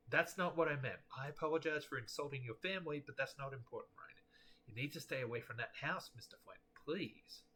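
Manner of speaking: usual speaking voice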